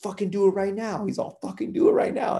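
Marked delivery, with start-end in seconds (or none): 0.0-1.1 high-pitched imitation of a friend daring someone; retold dare, high but not shouted; mock threatening. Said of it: "literally about to kill him". 1.4-2.4 high-pitched imitation of a friend daring someone; retold dare, high but not shouted; mock threatening. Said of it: "literally about to kill him".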